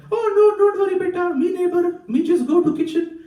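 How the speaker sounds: high, singsong Indian auntie in broken English; breezy Indian auntie, shrugging it off; auntie with Indian accent. Retold or imitated. Comic mimics the neighbor auntie taking their china, high Indian-accented singsong. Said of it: "And she's like".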